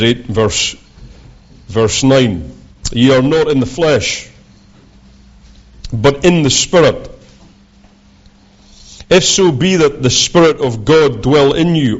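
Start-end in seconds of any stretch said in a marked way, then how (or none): 2.9-12.0 scripture reading. Acted. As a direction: read the verses out like a preacher. Reads Romans aloud, preaching.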